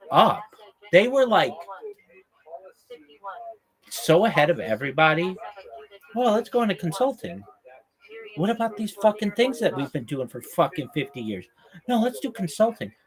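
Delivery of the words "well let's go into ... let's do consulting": a lightly voiced smug boss, pitched higher; mock clueless executive. Mocking IBM bosses choosing consulting, a hint of a smug executive. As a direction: sneer out the boss's dumb plan in a higher voice.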